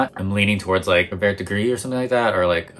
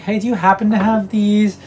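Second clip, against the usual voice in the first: sweet ask; 12 semitones higher, 3 dB louder than usual. Retold or imitated. Asking sales associate for shoes.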